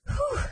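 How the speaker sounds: a loud, high whew of relief; relieved sigh. A narrator's reading. Prince relieved the girls are not dead, sighing whew. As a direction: let the worry out in one big sigh. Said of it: "sighed the prince".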